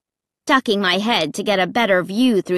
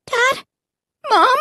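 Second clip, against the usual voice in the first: high, alarmed questioning calls; anxious child's voice; frightened calling. A narrator's reading. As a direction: call out for your parents, pitch jumping.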